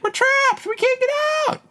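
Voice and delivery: very high, squeaky little voice; tiny soldier voice. Pretend. Voicing sperm as little soldiers, squeaky high plea. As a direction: squeak it like a tiny trapped creature. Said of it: "That's how they talk, little soldiers".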